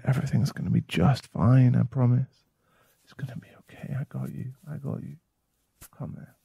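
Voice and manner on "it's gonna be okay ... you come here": soft whispered comfort; soothing whisper. Acted. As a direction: hush her gently. Soothing tied-up girlfriend.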